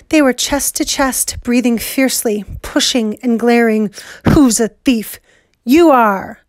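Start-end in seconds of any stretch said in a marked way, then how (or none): 4.3-6.4 angry. Acted. Chest-to-chest quarrel.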